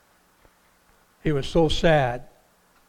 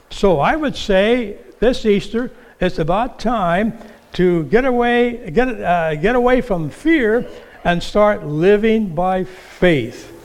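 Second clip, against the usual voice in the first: louder, rising exhortation; fervent preaching; 4 semitones higher, 4 dB louder than usual. Acted. Preacher urges his church this Easter, rousing and loud. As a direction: press the church to leave fear behind.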